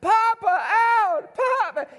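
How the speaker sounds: put-on very high, loud child's wail; baby begging for grandpa; toddler crying out. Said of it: "and here's".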